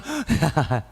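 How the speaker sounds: short put-on "ha-ha-ha"; demonstrated laugh. Shown as a demonstration. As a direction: fake a quick burst of laughter. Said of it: "சிரிக்க சொன்னா". (போலிச் சிரிப்பு)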